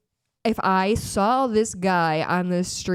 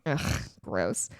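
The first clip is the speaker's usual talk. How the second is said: annoyed ugh; 11 semitones lower, 7 dB quieter than usual. Real, not acted. Disgust at news story.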